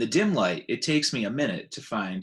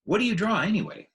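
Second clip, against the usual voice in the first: put-on much higher, eager child's voice; curious little elephant; 11 semitones higher, 4 dB louder, faster than usual. Ruby the young elephant asks.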